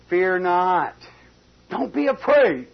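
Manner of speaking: loud and high, a bold proclamation; Don't be afraid, ringing out loud; preached reassurance. Acted. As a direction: cry it out to steady the servant. Elisha reassuring servant, preached.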